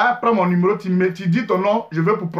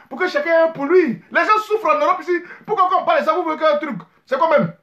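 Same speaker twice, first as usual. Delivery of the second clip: indignant; 7 semitones higher than usual. Real, not acted. Indignant about taboo topic.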